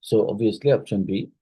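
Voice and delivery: usual speaking voice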